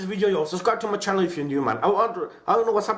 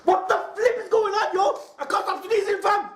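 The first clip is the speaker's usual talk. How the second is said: high, giggling through it; laughing; 14 semitones higher than usual. Real, not acted. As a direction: laugh while you speak. Laughing during sneezing fit.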